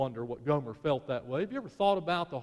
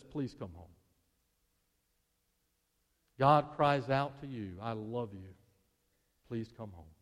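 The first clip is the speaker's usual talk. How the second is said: much lower and far softer; soft plea; 7 semitones lower, 5 dB quieter than usual. Acted. God calling the listener home, hushed plea. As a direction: plead gently, almost a breath. God's plea voiced softly.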